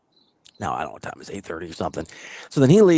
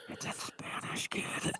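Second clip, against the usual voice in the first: put-on hushed aside to a child; retold whisper; 15 dB quieter, much slower than usual. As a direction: whisper it discreetly to your son. Breaking news discreetly to his son.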